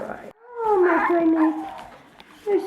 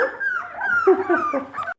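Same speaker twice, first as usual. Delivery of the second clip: high giggle; 4 dB quieter than usual. Real, not acted.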